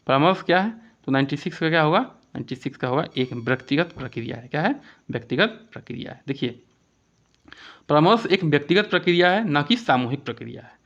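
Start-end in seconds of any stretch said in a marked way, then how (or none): none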